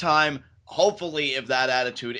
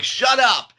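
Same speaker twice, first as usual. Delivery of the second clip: high, loud snapped shout; mock shout; 10 semitones higher, 9 dB louder than usual. Alexa snapping at the chanting crowd, loud mock yell. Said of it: "She's like".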